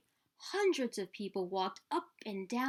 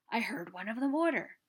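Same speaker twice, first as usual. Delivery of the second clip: usual speaking voice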